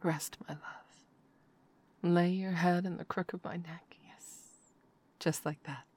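hushed afterglow murmur, mostly whispered; intimate whisper. Acted. Lover settling her partner to sleep, hushed whisper.

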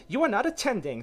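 high, loud scolding; very severe. A narrator's reading. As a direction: scold sharply in a high mouse voice. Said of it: "said the mouse to Alice very severely".

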